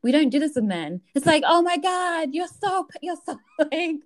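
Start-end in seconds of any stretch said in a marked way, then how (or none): 1.5-4.0 high, gushing fake admiration; mock gushing praise. Friend mocks how people praise women, in gushing fake admiration. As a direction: lay on the praise like an over-eager fan.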